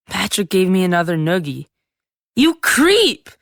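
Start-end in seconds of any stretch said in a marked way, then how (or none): 2.3-3.3 high, loud furious scream; angry scream. Acted. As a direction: scream it at the brother. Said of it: "I screamed".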